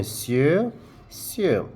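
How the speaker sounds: "sieu" said like sue, rising then falling; same sound twice. Shown as a demonstration. Teacher showing learners two homophones. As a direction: show they sound the same. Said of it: "They have the same pronunciation".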